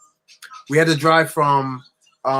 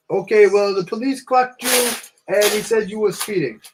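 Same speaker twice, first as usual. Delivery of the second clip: higher-pitched Italian cop impression; bad Italian accent; 7 semitones higher than usual. Police officer explaining the stop to an American driver, bad Italian accent. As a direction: put on a clumsy Italian accent. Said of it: "I'm doing a horrible impression".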